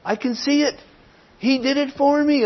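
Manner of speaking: high-pitched, dawning excitement, acted out; excited realisation. New believer finally seeing that sins are paid for, excited realisation. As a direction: voice someone thrilled to finally get it. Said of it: "You're excited because this person's finally seeing that".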